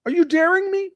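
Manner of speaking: high taunting question; mock challenge.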